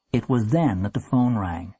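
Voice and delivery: ordinary delivery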